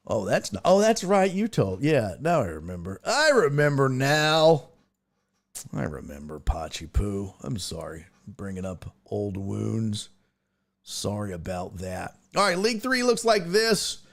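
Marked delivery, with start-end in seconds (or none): 5.7-12.1 low, soft, subdued apology; sheepish apology. Real, not acted. Host sorry for bringing up old wounds, sheepish apology. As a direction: drop right down and apologize quietly.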